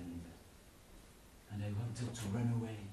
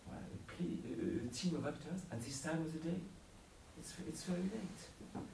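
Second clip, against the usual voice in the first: nervous inner voice; 8 semitones higher, 5 dB quieter, faster than usual. Acted. Narrator's doubtful inner thought.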